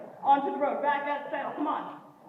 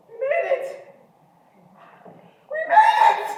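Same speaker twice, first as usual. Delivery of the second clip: very high, loud triumphant shout; relieved shout; 12 semitones higher, 9 dB louder than usual. Acted. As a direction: scream with relief. Arriving home after scary drive.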